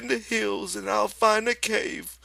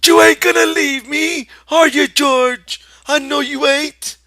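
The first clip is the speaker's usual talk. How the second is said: high, loud, anxious plea; pleading; 16 dB louder than usual. Acted. Lenny begs George not to leave, loud anxious. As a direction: beg for reassurance.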